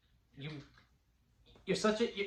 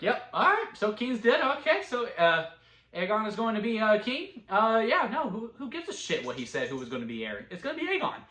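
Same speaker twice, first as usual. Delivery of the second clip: breezy dismissal; 7 semitones higher than usual. Retold or imitated. Lannisters dismissing the will.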